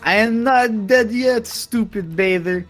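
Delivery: loud, high, drawn-out retort; in-character defiance. Acted. Jugs yells back at the enemy, defiant. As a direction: slowly proclaim it in defiant character.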